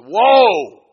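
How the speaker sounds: one loud, high yell; frantic stopping shout. Retold or imitated. Man stopping the preacher's prayer, frantic shout. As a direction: holler it to cut him off. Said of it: "he stopped me like that".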